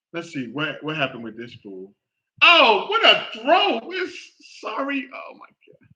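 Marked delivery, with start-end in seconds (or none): 2.4-5.1 higher, slow, mocking shout at the throw; real exasperation, cracking up high; sarcastic outcry. Real, not acted. 5.1-5.9 breathless whisper of disbelief; barely voiced, stunned; real shock, whispered; whispered dismay. Real, not acted. Aghast after the interception.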